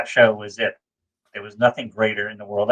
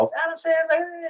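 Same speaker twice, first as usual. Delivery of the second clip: high, soft gushing blurt; starstruck blurt; 6 dB quieter than usual. Retold or imitated. Sister blurting about Adam Sandler, starstruck. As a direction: blurt out the celebrity's name. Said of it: "no filter as well".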